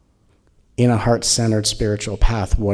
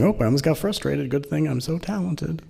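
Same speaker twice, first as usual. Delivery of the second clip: a light smug self-praise, faster; lightly voiced self-satisfied seeker; hammed-up smugness; smug mock voice; 5 semitones higher than usual. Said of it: "I'm not sitting here going".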